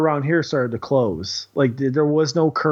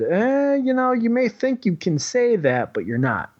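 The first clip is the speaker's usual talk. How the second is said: mock official; 8 semitones higher than usual. Mocking the health department's reply.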